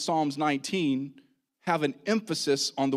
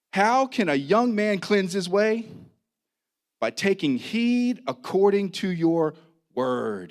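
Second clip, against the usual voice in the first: emphatic scripture reading; 4 semitones higher, 4 dB louder than usual. Real, not acted. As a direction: proclaim the verse with force. Resumes reading the psalm.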